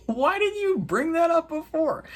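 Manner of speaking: high, squeaky disbelief; mock exasperation. Recapper scolding a character for withholding news, mock exasperated. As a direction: wail the complaint in a high hammed-up voice.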